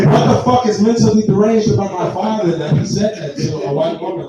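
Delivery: ordinary talk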